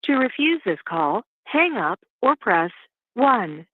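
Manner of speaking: tinny phone line; on a prison phone. Real, not acted. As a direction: sound like a recorded jail call. Recorded prison phone call played.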